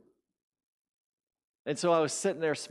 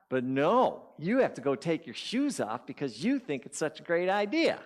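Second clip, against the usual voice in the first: scolding inner critic; 2 semitones higher than usual. Acted. Inner critic blaming the walker for bare feet, scolding. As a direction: berate yourself with mounting exasperation. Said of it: "listening to this voice in my head".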